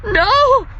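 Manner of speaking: high, loud shrieked no; scream. Acted. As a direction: scream it in panic. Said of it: "she screams".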